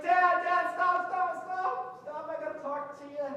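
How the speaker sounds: loud, high rushed stop stop; cutting off his father's hugging; urgent pleading. Retold or imitated. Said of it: "and the boy's like".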